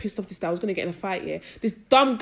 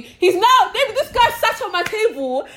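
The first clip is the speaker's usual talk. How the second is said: much higher and louder, cracking up; giggly high call to friend; laughing excitedly; 12 semitones higher, 6 dB louder than usual. Real, not acted.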